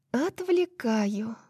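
high, very slow, drawn-out syllables; slow drawl. Acted. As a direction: stretch every syllable, teasing. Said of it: "медленно произносит она, растягивая слоги".

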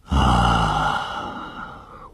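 low, drawn-out "ah"; long breathy sigh. Acted. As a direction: let out a long, falling sigh. Narrator's long sigh in the story.

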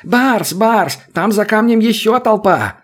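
high panicked shout; hysterical yell. A narrator's reading. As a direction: scream it out in panic. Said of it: "лесник истерично заорал".

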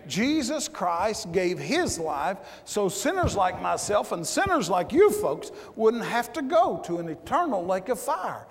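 ordinary delivery

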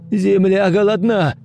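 high shout from the crowd; shouted cry. Acted. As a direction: cry it out from the dark. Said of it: "выкрикнул кто-то из темноты".

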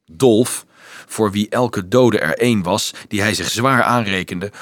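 usual speaking voice

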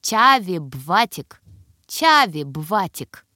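put-on Чави бватик, loud effortful toddler call; says w for r. A narrator's reading. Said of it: "кряхтение и новое усилие".

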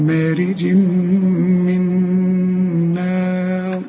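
مارج من نار chanted very slowly with a clear nun; slow recitation. Shown as a demonstration. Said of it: "النون اللي بقول لك استعمل فيها لسانك اهي".

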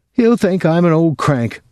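put-on high, whiny old mother; a complaining old mother on the phone. A narrator's reading. Mother frets.

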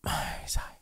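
long weary sigh; soft, voiceless exhale before answering. A narrator's reading. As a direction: sigh out softly, all breath. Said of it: "Thở dài, ông Kha liền đáp".

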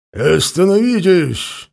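ringing shout to stop; loud call. A narrator's reading. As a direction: call out to halt them. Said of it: "раздался громкий возглас".

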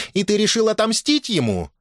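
high-pitched challenge from a mother; indignant questioning. A narrator's reading. Mother confronts son. (возмущённый вопрос матери)

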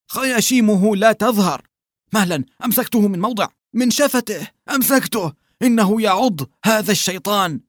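high, hurried voice gripping the fish; straining peasant. A narrator's reading. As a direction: grunt it out while grabbing. Gerasim struggles with fish. (فلاح يلهث وهو يمسك السمكة بصوت عالٍ)

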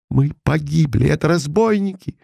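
terrified cry that robbers are coming; frightened exclamation. A narrator's reading. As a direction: blurt it out in fright, pitch climbing. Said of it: "воскликнул я".

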